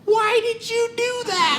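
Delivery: put-on old woman asking why he knocked her down; old lady's plea. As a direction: play a hurt tiny old lady. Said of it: "she goes".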